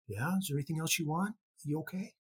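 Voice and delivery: gentle checking-in. Retold or imitated. Star to fan holding his hands, quietly concerned. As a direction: quietly check on someone who is staring.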